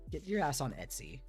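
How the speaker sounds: normal talking voice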